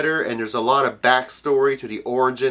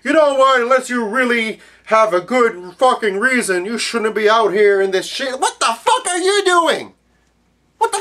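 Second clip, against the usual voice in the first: higher, louder earnest newsman; pompous news anchor warning, much higher; mocking Anderson Cooper; 7 semitones higher, 3 dB louder than usual. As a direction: parody a stern TV reporter scolding viewers. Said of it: "like Anderson Cooper, for example, saying".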